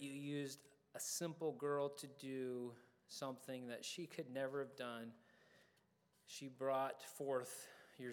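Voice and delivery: slow, measured prayer with long pauses; public prayer. Real, not acted. Preacher leading the congregation in closing prayer, slow and reverent. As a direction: pray aloud deliberately, phrase by phrase.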